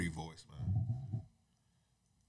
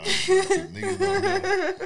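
laughing; 4 dB louder, much slower than usual. Real, not acted. Laughing while quoting himself.

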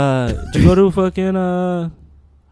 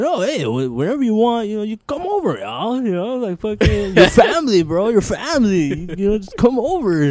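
higher-pitched dad urging a guest to visit; imitating someone's dad; 7 semitones higher than usual. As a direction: imitate a friend's dad being insistently welcoming. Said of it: "he talks like that".

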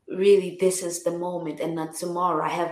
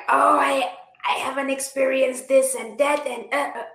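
higher-pitched, put-on griping; pitched-up mock whining; mock complaint; 7 semitones higher than usual. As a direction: voice an imagined grumble, trailing into stammers. Voicing a dissatisfied version of herself.

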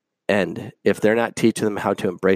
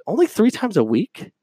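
sarcastic emphasis; 10 semitones higher than usual. Retold or imitated. Sarcastic reading of the ad.

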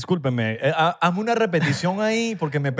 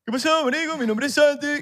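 imitating Santi; 10 semitones higher than usual. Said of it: "yo voy a decir ahorita".

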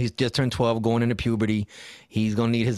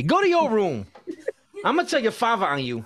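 high, playful scolding through laughter; mock parent scolding; 7 semitones higher than usual. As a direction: send the kid away in pretend outrage.

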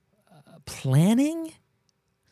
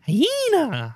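hyena laugh; 12 semitones higher than usual. Pretend. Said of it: "I wanna do, like, a, do a hyena laugh".

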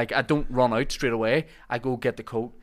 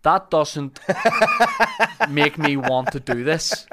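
laughing retort; 4 semitones higher, 7 dB louder, slower than usual. Retold or imitated. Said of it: "And I was like".

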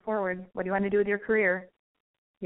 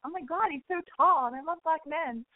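swooning; 8 semitones higher than usual. Retold or imitated. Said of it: "I was like".

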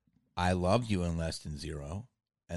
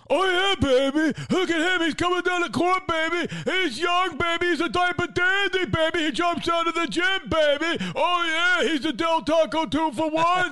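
much higher, shouted sportscaster hype; Dick Vitale; 10 dB louder than usual. Retold or imitated. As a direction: yell it like a hyped college basketball announcer. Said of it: "You know Dick Vitale?".

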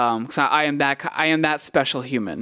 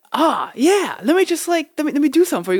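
excited, high-pitched and loud retelling; eager mock reply; 6 dB louder, faster than usual. Beatboxer offers to perform for a country cop, eager mock reply. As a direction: jump in high and eager, acting it out. Eagerly answering a cop's question.

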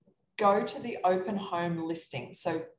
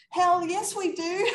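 much higher and louder, bursting with yes; excited yell; 11 semitones higher, 5 dB louder, slower than usual. Real, not acted. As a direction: shout it out proudly, laughing at the end. Excited answer about offering coaching.

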